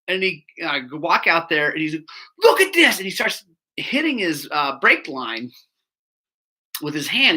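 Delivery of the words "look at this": high, loud indignant demand; mock screaming. Angry customer showing the mechanic his bike, mock screaming. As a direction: play an outraged customer yelling. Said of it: "so he's screaming at me".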